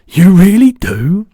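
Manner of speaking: loud, slow, high incredulous question; brightening amazement. A narrator's reading. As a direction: draw it out in delighted disbelief. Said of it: "cried the B F G, suddenly brightening".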